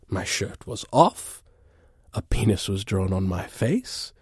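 ordinary talk

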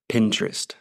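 "Pinterest" said in American English, Pin-trust; American accent. Shown as a demonstration. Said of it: "Eu vou falar com o sotaque americano".

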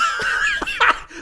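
high whispered elf giggle. Acted. Buddy the Elf bell.

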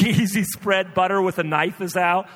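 ordinary delivery

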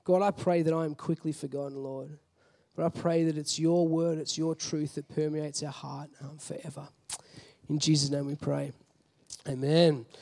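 hushed, low closing prayer. Acted. Low closing prayer to the congregation. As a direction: pray softly to God for the church.

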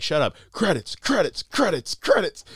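high, repeated mock heckling; mock crowd calling for credits; imitating impatient crowd. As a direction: cut the speech off with high-pitched chants. Everyone shouting down Robin's speech.

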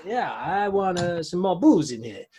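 put-on lighter comic Italian accent; mild hammed-up Italian accent; mock Italian accent. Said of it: "an Italian came back from his travels".

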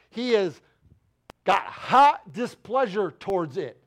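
ordinary talk, nothing marked

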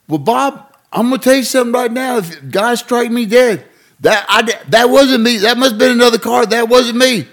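high hammed-up country denial; mock innocent pleading. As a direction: protest innocence big and high. Sid swears innocence to cop.

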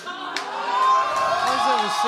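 shout drawn out. Real, not acted. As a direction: call the reading out slowly. Résultat de l'alcootest lu. (annonce criée et étirée)

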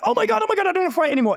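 high-pitched panicked surrender; panicked pleading. Retold or imitated. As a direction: squeal it out like he's in agony and giving up. Tom panicking, begging to stop.

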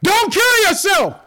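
high, loud, drawn-out shout; urgent yell. Acted. Paul stopping the jailer's suicide, yelling out. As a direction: yell slowly across a prison, high-pitched. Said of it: "Paul yells out".

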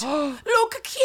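high-pitched fake Jamaican; hammed-up Jamaican dialect; bad Jamaican accent. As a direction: squeal it in awful mock Jamaican. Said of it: "supposed to be talking in a Jamaican dialect".

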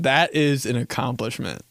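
usual speaking voice

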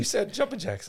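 high mock drill instructor. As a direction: play the nagging instructor.

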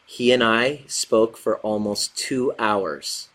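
slow, deliberate reread of the message; slow emphatic reread. Shown as a demonstration. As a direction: read it back slowly, letting each word land.

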